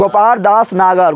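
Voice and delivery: slow, each word spaced out; slow dictation. Shown as a demonstration. Teacher giving the answer to students, slowly. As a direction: spell the name out slowly for the class. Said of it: "अरे बोल जल्दी".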